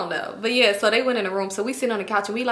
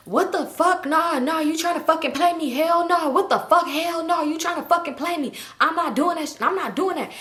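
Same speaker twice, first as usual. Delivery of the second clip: higher-pitched imitation of an outraged man; loud indignant talking; 7 semitones higher than usual. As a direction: act out his protest, rapid-fire refusals. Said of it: "like, loud talking".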